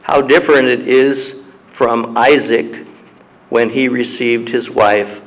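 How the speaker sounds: ordinary delivery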